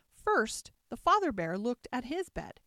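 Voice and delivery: ordinary delivery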